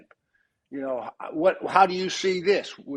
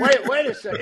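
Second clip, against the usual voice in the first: laughing; 8 semitones higher, 4 dB louder than usual. Real, not acted. Laughing while retelling the scene.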